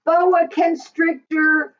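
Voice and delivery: ordinary delivery, nothing marked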